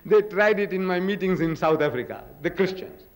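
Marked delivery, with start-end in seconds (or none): none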